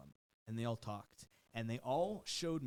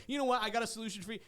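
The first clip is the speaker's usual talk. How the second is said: higher, louder mock-smooth pitch; mock slick trainer; 9 semitones higher, 8 dB louder, faster than usual. Host mocking the trainer to the girl, a mock slick pitch. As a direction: sell it like a sleazy salesman.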